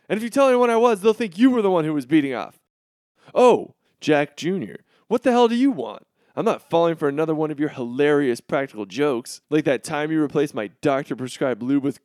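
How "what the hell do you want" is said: normal talking voice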